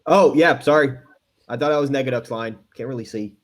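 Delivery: ordinary talk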